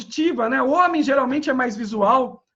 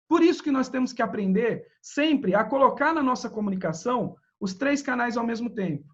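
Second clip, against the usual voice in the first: normal talking voice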